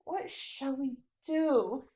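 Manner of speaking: usual speaking voice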